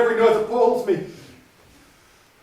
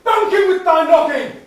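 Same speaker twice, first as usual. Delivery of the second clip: much higher, loud wail; anguished cry; 8 semitones higher, 8 dB louder than usual. Acted. Husband crying out at the knocking, high and loud. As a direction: cry out in guilt.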